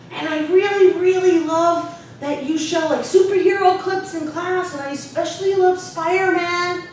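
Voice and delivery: imitated exuberant kid, high and loud; youthful childlike student. Student raving to teacher about superhero clips, childlike exuberance. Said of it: "childlike way of speaking".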